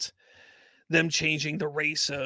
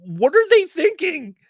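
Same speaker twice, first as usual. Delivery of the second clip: incredulous; 11 semitones higher, 6 dB louder than usual. Real, not acted. Exasperated rant about casting.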